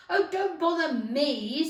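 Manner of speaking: loud, slow annoyed Duchess; irritated snap. Acted. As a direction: wave Alice off, fed up. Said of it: "said the Duchess".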